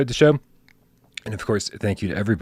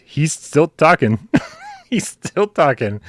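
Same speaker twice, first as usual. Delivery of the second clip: slow, higher, giggly delight; amused, drawn out; 7 semitones higher, 3 dB louder, slower than usual. Real, not acted. Host gloating to chat that the muted cohost is still talking, slow and amused. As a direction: savor the joke slowly.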